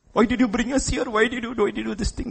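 high-pitched complaint, hurrying along; mock complaining. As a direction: imitate a newlywed wife nagging her husband. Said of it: "like newlywed wives will complain to husbands".

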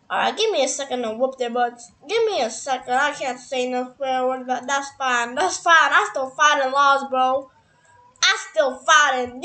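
usual speaking voice

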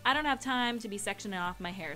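normal talking voice

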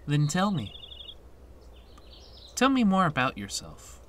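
lifted, inviting teacher voice; coaxing teacher. Acted. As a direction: coax a withdrawn student to open up.

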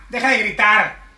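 loud scolding yell. Acted. Parent yelling back at teen to stop screaming, loud. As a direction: shout the order right back.

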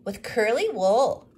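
put-on boy adding details about the sheep; eager little boy. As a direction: chime in excitedly. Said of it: "added Thomas".

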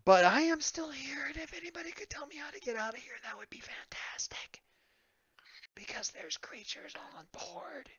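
high, hushed stage whisper; whispering distress call. Acted. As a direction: whisper a plea over the radio, as if creatures might hear. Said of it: "completely different voice, also whispering".

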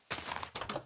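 a short, soft, airy gasp; startled gasp. Real, not acted. Gamer meets a sudden threat, soft startled gasp. Gasps at a new danger.

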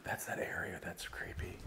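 hushed creeped-out whisper. Real, not acted. Ghost hunter in the dark cell, a creeped-out whisper. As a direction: whisper so nothing hears you.

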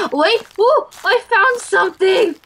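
high excited exclaiming; excited discovery. Real, not acted. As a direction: blurt it out, thrilled at the find. Finds a page in game.

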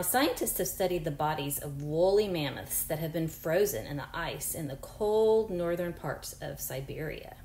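normal talking voice

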